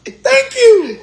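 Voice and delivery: squealed high and loud through laughter; laughing thanks. Real, not acted. Host cracking up at caller, squealing laughing thanks. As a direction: shriek it out laughing.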